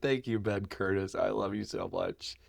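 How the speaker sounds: mild imitation of a friend's tender thanks. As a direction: say it softly like a heartfelt hug. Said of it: "He said".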